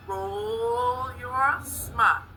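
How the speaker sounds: very slow, stretched class chant; drawn-out cheer. Pretend. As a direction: stretch out each word as a cheer.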